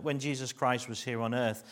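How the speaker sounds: ordinary talk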